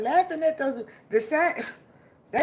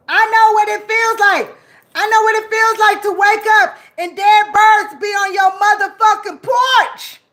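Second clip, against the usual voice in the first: loud raised ranting shout; angry shout; 4 semitones higher, 7 dB louder than usual. Real, not acted. Raging at enemies doing magic on her, shouting. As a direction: shout it out in fury.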